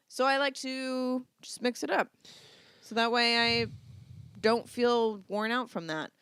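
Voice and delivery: ordinary delivery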